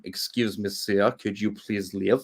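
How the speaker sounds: put-on low, slow, polite French accent; courteous request in a joking French accent. European politely asking someone to leave, French accent. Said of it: "That was my French accent".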